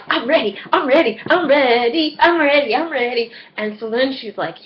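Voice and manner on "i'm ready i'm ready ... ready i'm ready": high-pitched eager chant. Retold or imitated. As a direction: act out bouncing eagerness, over and over. Acting out own eagerness to start.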